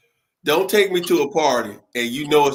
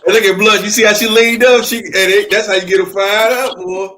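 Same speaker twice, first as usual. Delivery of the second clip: excited loud; 4 semitones higher, 9 dB louder than usual. Real, not acted. Loud excited laughing reaction.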